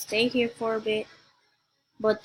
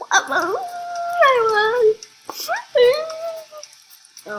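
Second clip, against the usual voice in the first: higher and louder, cheering the win; thrilled cheer; 7 dB louder than usual. Real, not acted. Gamer hearing she won the race, thrilled cheer. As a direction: cry out the victory in delight. Celebrating victory.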